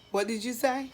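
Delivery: ordinary delivery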